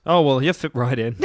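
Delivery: usual speaking voice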